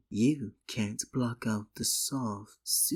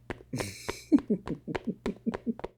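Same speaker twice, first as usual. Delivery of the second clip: delighted villainous giggle; 7 semitones higher than usual. Acted.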